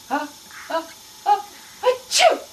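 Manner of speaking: playful fake sneeze, ends on a loud high achoo. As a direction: build up then sneeze for the toddler. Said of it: "he's gonna do a fake sneeze".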